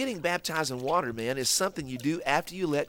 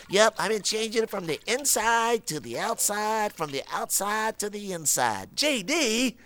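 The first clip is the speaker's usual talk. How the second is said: high proud puppet voice; 7 semitones higher than usual. Acted. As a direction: brag like a goofy puppet. Puppet brags about underwear.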